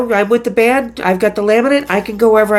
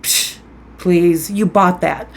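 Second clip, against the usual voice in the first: scoffing; 5 semitones lower, slower than usual. Pretend. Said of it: "And you're gonna think".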